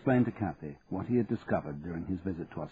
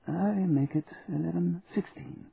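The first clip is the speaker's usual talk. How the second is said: put-on dry, measured old solicitor; lightly voiced precise old lawyer; 9 semitones higher than usual. A narrator's reading. Baverstock answers the time.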